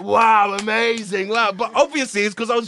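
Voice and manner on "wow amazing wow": amazed. Retold or imitated. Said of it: "everyone was like".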